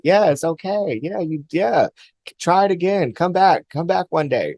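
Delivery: sing-song invitation to come back; encouraging spirit voice. Retold or imitated. Loving energy urging the dreamer to return, high and cheerful. The hug-like energy speaking to her.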